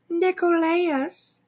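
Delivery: stretched, high "Nicolaus?" in disbelief; mocking the mispronunciation. Repeating the hosts' wrong name in slow, high disbelief. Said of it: "It's just [name]".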